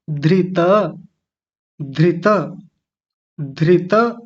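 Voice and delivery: धृत said clearly for the class to echo; repeat after me. Shown as a demonstration. Teacher leading the students in repetition. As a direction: model धृत for the class to copy. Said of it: "मेरे पीछे पीछे बोलिए".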